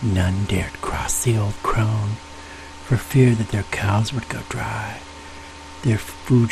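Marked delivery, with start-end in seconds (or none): none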